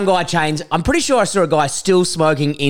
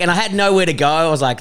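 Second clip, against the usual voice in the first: normal talking voice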